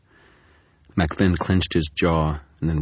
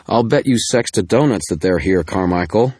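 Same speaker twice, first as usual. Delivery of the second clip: louder, sugary but poisonous taunt; sweet venom; 4 semitones higher, 7 dB louder than usual. Acted. Murphy taunting Carmichael, dripping with sweet venom. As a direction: sweeten the words to sting him. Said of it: "her voice dripping with sweet venom".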